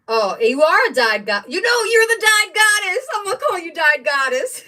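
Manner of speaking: high, loud, gushing praise with a laugh; gushing excitement. Real, not acted. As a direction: rave about her like a fan. Gushing over the dyed fabric.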